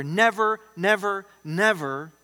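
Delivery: slow down and pound every NEVER; emphatic repetition. Shown as a demonstration. Preacher reminding believers they are not alone, emphatic. Preacher stresses threefold promise.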